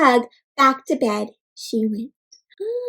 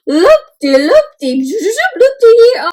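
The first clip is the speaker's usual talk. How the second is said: slow then high, loud swooping sing-song; excited loop-de-loop; 7 semitones higher, 8 dB louder, much slower than usual. Real, not acted. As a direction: swoop the voice like a looping flight. Tracing penguins' wild flight path.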